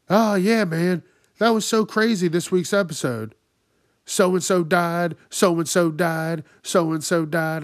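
mocking loud, high-pitched loudmouth; obnoxious loud talking. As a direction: imitate someone broadcasting to the whole room. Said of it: "like, just loud talking".

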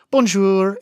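"Bonjour" high, loud and lilting; nailed French greeting. Retold or imitated. Walking into a shop, confident "Bonjour" pitched high. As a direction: call out "Bonjour" like a local. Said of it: "like, I nail that".

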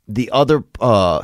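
usual speaking voice

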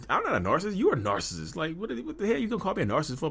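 high, indignant retort; retold indignant denial. Husband re-enacts denying his wife's narcissist accusation, indignant. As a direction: fire back, offended.

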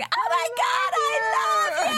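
hammed-up screeching fan in the background; screeching fan girl. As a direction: scream it like a starstruck fan. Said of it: "this screeching girl in the background going".

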